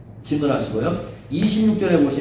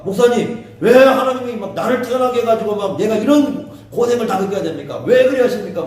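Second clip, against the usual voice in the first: high, raised-voice acted protest; heated challenge; 6 semitones higher, 4 dB louder than usual. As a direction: plead and argue with the pastor. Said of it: "막, 막 따지면은". (높은 목소리로 막 따지는 흉내)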